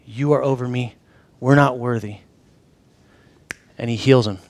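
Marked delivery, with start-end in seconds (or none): 0.0-2.2 soft, low confession; quiet, reverent deference; humble submission. Acted. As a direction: say it humbly, voice lowered. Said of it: "putting themselves underneath him".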